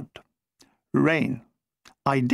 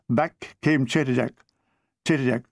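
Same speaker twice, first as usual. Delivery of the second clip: ordinary delivery, nothing marked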